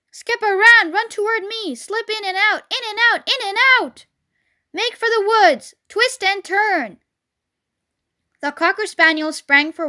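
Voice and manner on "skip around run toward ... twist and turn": high-pitched urgent coaching cries; cried orders. A narrator's reading. Goose directing Wilbur through the chase, cried orders. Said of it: "cried the goose".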